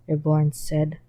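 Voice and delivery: plain reading voice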